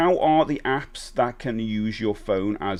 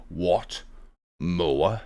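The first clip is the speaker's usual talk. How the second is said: low outraged "What? More?"; shocked stern master; 6 semitones lower than usual. Acted. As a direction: bark it low, appalled.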